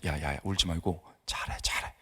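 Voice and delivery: soft, low, hushed coaxing; soothing. Retold or imitated. Pastor calming a crying student, softly soothing. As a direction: gently hush someone who is crying. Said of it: "분위기를 이제 달래면서".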